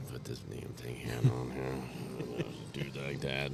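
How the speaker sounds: low, slow grumble under breath; grumbling. Real, not acted. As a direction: mutter it reluctantly. Grumbling while putting on hat.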